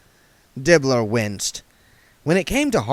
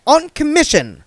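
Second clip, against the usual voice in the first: sharp, much higher and louder cut-in; snapped; 8 dB louder than usual. Acted. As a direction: bite the words off impatiently. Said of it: "snapped Saccharissa".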